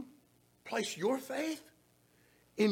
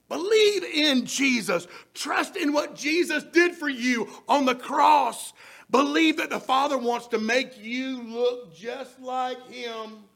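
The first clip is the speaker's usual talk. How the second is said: loud, higher, repeated shouted appeal; preaching at full force; 3 semitones higher, 5 dB louder than usual. Acted. Preacher urging the congregation to trust, in a loud shouted appeal. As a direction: urge them on with rising force.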